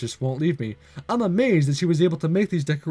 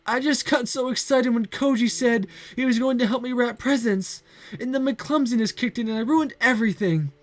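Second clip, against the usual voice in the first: tearful; 9 semitones higher than usual. Acted. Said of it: "to see her so sad".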